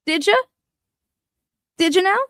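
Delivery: mock the claim, sarcastic; sarcastic sing-song did you now; mocking sarcasm. Real, not acted.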